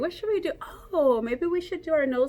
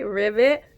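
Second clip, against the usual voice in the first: louder playful ribbit; frog croak; 3 semitones lower, 5 dB louder than usual. Acted. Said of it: "I liked making the noises".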